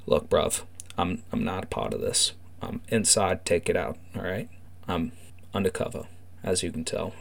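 low, hushed-down gang insider; confiding undercover informant. Acted. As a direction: let them in on your secret, low and soft.

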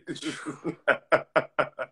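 real, drawn-out laughing fit; laughter. Real, not acted. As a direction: keep laughing helplessly. Cracking up at listener's face.

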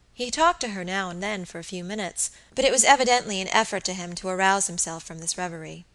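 plain reading voice

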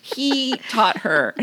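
ordinary talk

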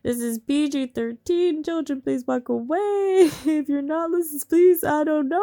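loud, high, outraged laughing rant. Real, not acted. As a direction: rant in shocked disbelief. Outraged reader reacting to cheating.

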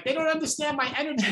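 high, fast, exasperated. Retold or imitated. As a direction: blurt it out in frustration. Quoting own frustrated thought.

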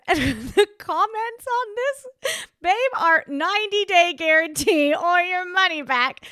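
reading comments while laughing, high and loud. Real, not acted.

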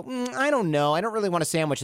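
high, hesitant, whiny indecision; mock dithering tourist. Tourist dithering to a New Yorker, mock whiny and high. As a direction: play a fussy, undecided visitor.

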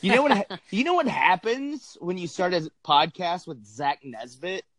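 ordinary delivery

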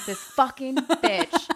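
loud, slow, drawn-out cursing; exasperated insult. Acted. Host cursing the movie's tattletale, loud exasperation.

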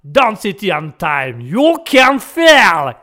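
song title stretched very slow and loud in a Texan accent; American Texan accent. Shown as a demonstration. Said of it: "Ça, c'est l'américain".

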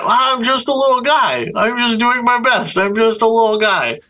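high, small cutesy voice; little guy voice. Pretend. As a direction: squeak it out like a tiny guy. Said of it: "weird little Gen Z phrases is that".

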